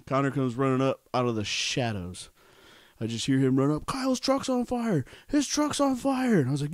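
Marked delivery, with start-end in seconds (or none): 3.8-6.4 much higher, frantic retold cry; frantic friend. Friend running up out of the shadows, frantic. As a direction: play the friend running up in alarm.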